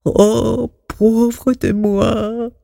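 lost, despairing. A narrator's reading. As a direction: moan it in self-pity, stretching the words. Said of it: "Toto se sentait perdu".

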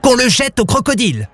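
high outraged vizier cry; a pompous vizier shrieking in offence; high indignant court official; vizier shouting. A narrator's reading. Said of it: "hurla le vizir".

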